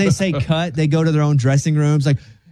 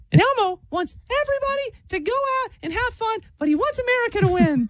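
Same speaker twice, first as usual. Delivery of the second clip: Elmo voice; 5 dB quieter than usual. Retold or imitated. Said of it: "Elmo did a video".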